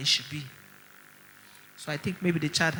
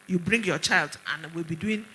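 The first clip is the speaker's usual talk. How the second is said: ordinary delivery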